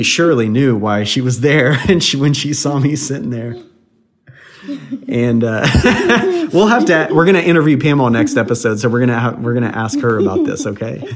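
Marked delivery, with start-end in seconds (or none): 5.7-6.5 high burst of laughter. Real, not acted. Laughing at his own story.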